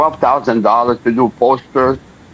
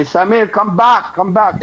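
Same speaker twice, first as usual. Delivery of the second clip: calling after; 6 semitones higher than usual. Retold or imitated. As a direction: call him back as he walks away. Boss calling after him outside.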